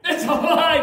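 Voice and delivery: high, loud, amazed carnival barker; hammed-up astonished street performer; Arthur Harris's showman act. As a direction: gasp and marvel at the hat to draw a crowd. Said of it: "And he'd go like this".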